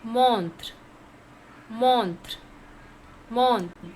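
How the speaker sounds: "Montre" as MONTR, one falling syllable with light final tr; pronunciation demo. Shown as a demonstration. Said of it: "How to pronounce?".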